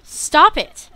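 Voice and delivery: loud, high shout of stop it; real yell. Real, not acted. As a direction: snap at the screen in real frustration. Yelling at game boss.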